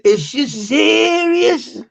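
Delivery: high, drawn-out mock outrage; mock incredulous slang. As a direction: stretch SERIOUS out loud and high. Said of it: "How can you say that?".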